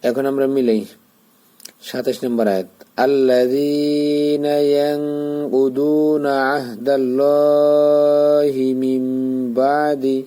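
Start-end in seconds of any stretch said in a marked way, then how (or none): 3.1-10.2 slow, melodic tajweed chant of the verse; Quran recitation. Shown as a demonstration. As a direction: recite the verse in a long, sung tilawat. Teacher recites the whole verse. (শিক্ষক পুরো আয়াত ধীরে সুর করে তিলাওয়াত করছেন)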